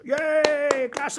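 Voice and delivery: high, whooping crowd cheer; exuberant cheering. A narrator's reading. Audience cheers the hedgehog's rattling show, exuberant. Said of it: "ihre Begeisterung durch überschwängliches Geschrei".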